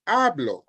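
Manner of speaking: "hablo" stressed on the first syllable, HA-blo. Shown as a demonstration. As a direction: lean on the "ha" of "hablo". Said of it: "both words have stress that are to be observed".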